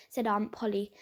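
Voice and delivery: ordinary delivery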